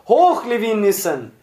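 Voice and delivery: high, ringing salute; rousing shout. A narrator's reading. Whole prison cheers from every cell window, shouting. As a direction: cry out as a whole prison would. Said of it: "Das ganze Haus schrie".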